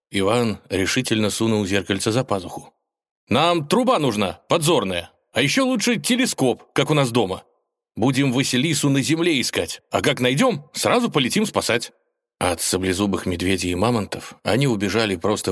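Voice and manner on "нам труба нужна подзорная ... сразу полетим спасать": put-on high-pitched eager young hero; excited high character voice; eager Ivan, planning. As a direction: lay out the plan in a high, lively voice.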